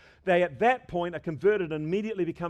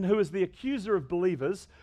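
ordinary talk, nothing marked

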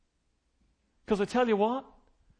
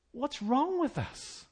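normal talking voice

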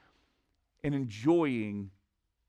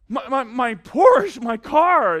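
loud, high, slow stammering wail; mock-horrified cry, stuttering over the words; distraught stammer; 10 semitones higher, 11 dB louder, slower than usual. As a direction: imitate a stunned owner stammering in anguish. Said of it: "He started to stammer".

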